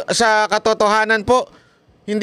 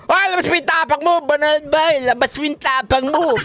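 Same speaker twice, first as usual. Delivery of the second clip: mocking taunt; 7 semitones higher than usual. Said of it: "ganyan-ganyan sila eh".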